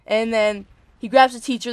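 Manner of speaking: ordinary delivery, nothing marked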